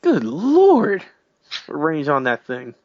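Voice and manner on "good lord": high, loud good lord; exasperated outburst. Retold or imitated. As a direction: blurt it out, fed up. Exasperated at the opponent's move.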